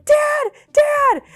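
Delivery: high panicked shouts; frantic high yell for Dad. Retold or imitated. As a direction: call out in fright. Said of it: "I just start going".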